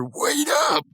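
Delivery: high mock shout of Wait up; a lightly voiced Muscle Man yelling from behind; Muscle Man calling. Reviewer imitating Muscle Man calling out, high and mock. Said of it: "And it's Muscle Man".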